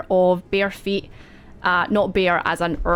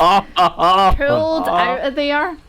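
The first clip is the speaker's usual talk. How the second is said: slow, higher and louder; drawn-out dramatic; 5 semitones higher, 5 dB louder, much slower than usual. A narrator's reading. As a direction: stretch it for drama. GM dramatic narration.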